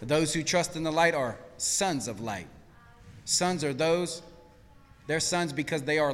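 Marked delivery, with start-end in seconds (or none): none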